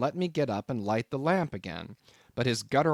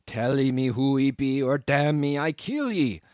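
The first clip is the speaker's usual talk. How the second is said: loud growling cannibal threat; menacing cannibal roar, louder; growled threat; 2 semitones higher, 5 dB louder than usual. A narrator's reading. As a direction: growl it like a savage about to strike. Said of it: "again growled the cannibal".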